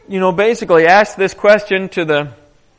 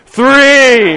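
called-out count, much louder; 5 semitones higher, 9 dB louder than usual. Retold or imitated. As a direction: announce each dip to the crowd. Counting dips in the river.

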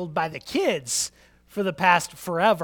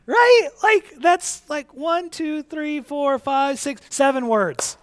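mock breezy; 10 semitones higher, 8 dB louder, slower than usual. Mocking how easy the verse seems.

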